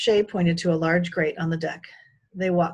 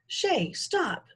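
calling out; 9 semitones higher than usual. A narrator's reading. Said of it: "Jed called".

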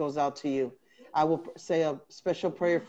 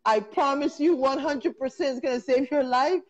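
laughing, high-pitched; 7 semitones higher than usual. Real, not acted. Laughing defence of wild cures.

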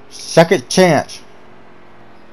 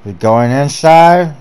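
ordinary talk, nothing marked

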